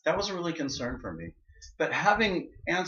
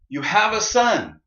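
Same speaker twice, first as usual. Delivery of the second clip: announcing; 7 semitones higher, 10 dB louder, slower than usual. Retold or imitated. Doctor announcing the birth.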